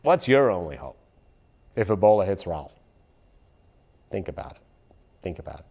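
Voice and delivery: ordinary talk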